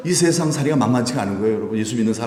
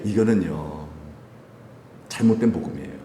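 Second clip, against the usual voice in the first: usual speaking voice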